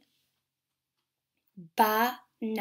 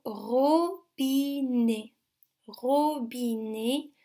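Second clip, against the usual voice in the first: "robinet" stretched out slowly, syllable by syllable; slow careful word; much slower than usual. Shown as a demonstration. Teacher slowly presenting the word to children. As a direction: draw the word out for young listeners.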